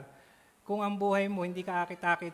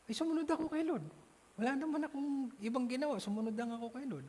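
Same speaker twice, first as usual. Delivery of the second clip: humble believer voice; 7 semitones higher, faster than usual. Acted. Acting a changed believer's reply.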